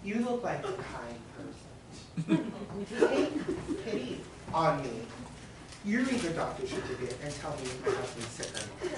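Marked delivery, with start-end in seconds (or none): 0.0-7.6 pleading. Acted. Woman pleads with clerk.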